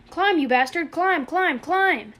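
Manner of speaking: frantic yelled commands; screamed order. A narrator's reading. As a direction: yell it like lives depend on it. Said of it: "he screamed on the".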